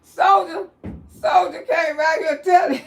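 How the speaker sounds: high, loud, whooped-up shout; amused hollering. Real, not acted. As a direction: shout his name in gleeful disbelief. Host hollering about the caller.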